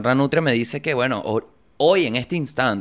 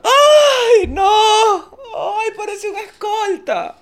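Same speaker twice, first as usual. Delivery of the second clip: hammed-up sobbing shout, much higher and louder; mock sobbing; 6 dB louder, much slower than usual. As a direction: wail it like a girlfriend in comic tears. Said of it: "Y se puso a llorar". (llanto exagerado y agudo entre risas)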